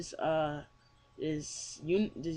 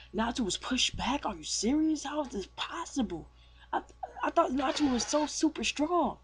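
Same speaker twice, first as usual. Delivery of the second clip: high, incredulous voice for a stunned sidekick; mock astonishment; 8 semitones higher than usual. Stunned cat reacting to his friend's defeat, astonished. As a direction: act out disbelief, pitched well up. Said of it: "Happy's just down".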